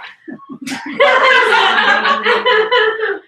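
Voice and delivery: loud high burst of laughter; big laugh. Real, not acted. Speaker laughing at her own Kevin story, loud and high. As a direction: let a big laugh ring out.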